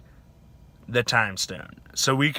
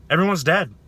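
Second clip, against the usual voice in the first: ordinary talk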